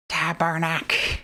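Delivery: put-on slow, drawn-out Quebecois accent; hammed-up Quebecois accent; cursed Quebecois accent. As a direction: stretch out a Quebecois swear. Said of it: "cursed Quebecois accents".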